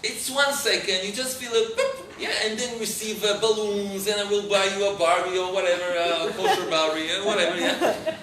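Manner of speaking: put-on high, hurried sales pitch to a child; eager coaxing. Father bribing his daughter before the shot, eagerly coaxing. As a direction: talk up the treats in a high voice.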